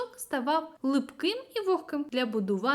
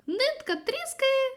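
ordinary delivery

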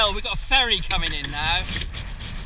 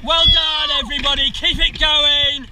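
race coxing calls; 7 semitones higher, slower than usual. Real, not acted. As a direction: push the crew hard, shouting each stroke. Cox calling rowing race.

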